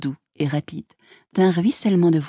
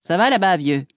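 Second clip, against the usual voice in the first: lightly raised calling voice; called out. A narrator's reading. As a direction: friendly call across a distance. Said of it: "quelqu'un cria".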